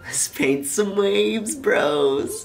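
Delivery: slow, stretched-out laid-back imitation; mock super-chill. Painter telling his bros to paint waves, mock super-chill. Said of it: "He's, like, being so chill".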